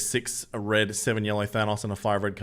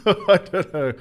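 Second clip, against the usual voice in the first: real laugh spilling over the words, higher and louder, slowed; 10 semitones higher, 8 dB louder, slower than usual. Real, not acted. Streamer cracks up at the shocked or stoked pun, laughing. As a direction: laugh through it.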